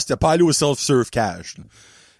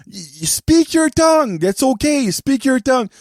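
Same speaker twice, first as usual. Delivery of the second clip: put-on flustered old lady; 6 semitones higher than usual. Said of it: "Et comme".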